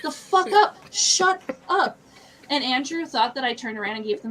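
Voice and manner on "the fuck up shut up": high, loud exasperated outburst; mock-furious shout; retold angry yell. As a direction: snap at giggling girls, re-enacted. Acts out yelling at girls.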